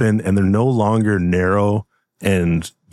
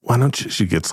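ordinary talk

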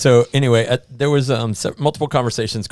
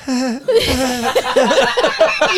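high, loud laughing cry; hammed-up wail of embarrassment; mock anguished wail; 7 dB louder than usual. Bride acts out her face during the fight, mock anguished wail. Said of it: "I was like".

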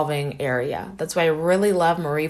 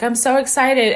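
higher, louder excited exclamation; 7 semitones higher, 6 dB louder than usual. Retold or imitated. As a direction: quote your own excited greeting. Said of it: "uh, say things like".

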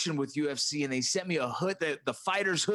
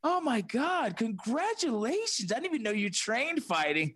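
mock fans gushing; 7 semitones higher than usual. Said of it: "People were like".

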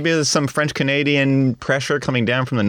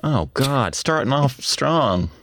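ordinary delivery

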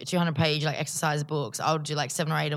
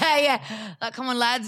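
higher Western Sydney accent breaking into a laugh; 7 semitones higher, 3 dB louder, slower than usual. Shown as a demonstration. Rounding off her Westie demo, a Western Sydney accent with a laugh. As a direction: finish the Westie bit, laughing at yourself. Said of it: "Give us something in the Westie".

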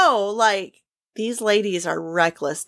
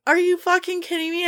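much higher, tearful outburst of disbelief; overwhelmed disbelief; 10 semitones higher than usual. Retold or imitated. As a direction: squeak it out, too moved to believe it. Overwhelmed by stranger's kindness.

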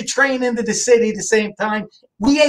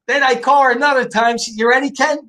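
ordinary talk, nothing marked

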